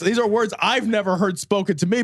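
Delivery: usual speaking voice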